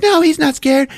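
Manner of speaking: high-pitched, loud retort; imitating a wife arguing back; insistent denial. Acted argument, wife snaps back.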